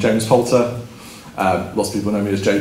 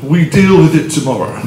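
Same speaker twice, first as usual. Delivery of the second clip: imitated French accent, higher pitch; 4 semitones higher, 3 dB louder than usual. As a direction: quote the Frenchman's catchphrase in his accent. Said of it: "with more of a French accent".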